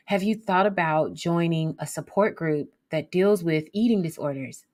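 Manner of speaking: normal talking voice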